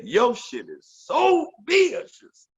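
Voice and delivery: "so vicious" drawn out slow and high; exaggerated emphasis. Imitating Mario's funny compliment, heavy drawn-out emphasis on "so vicious". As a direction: lean hard on "so vicious", stretching it in a high imitation. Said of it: "he put some emphasis on that shit".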